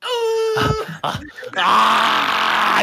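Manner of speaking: high piercing wail; wailing cry. Acted. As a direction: wail out loud. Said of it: "He just starts wailing".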